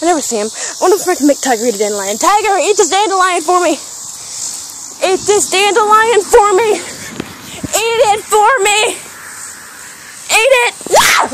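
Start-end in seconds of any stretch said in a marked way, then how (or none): 2.2-10.7 high, increasingly drawn-out urging; coaxing a pet. Real, not acted. Urging her pet to eat dandelion. 10.9-11.3 sudden high scream; startled, loud shriek. Real, not acted. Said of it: "If my screams hurt your ears".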